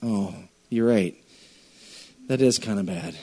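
sheepish admission. Retold or imitated. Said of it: "slump your shoulders and go".

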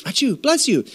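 a quick, high fake sneeze then bless you; mock sneeze. Preacher mimics a sneezer, mock sneeze. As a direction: play the sneeze light and high.

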